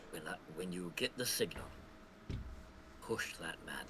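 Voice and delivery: low, hushed stage whisper. Acted. Player whispers orders to Killian, hushed and low. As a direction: pass it on secretly under your breath. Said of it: "I'm gonna whisper to Killian".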